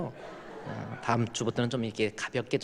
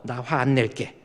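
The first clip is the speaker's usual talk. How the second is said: louder promise to spouse; congregation repeat; 7 dB louder, slower than usual. Shown as a demonstration. Pastor leads congregation promising spouse, loud. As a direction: say it out to your spouse. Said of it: "우리 한번 따라합시다".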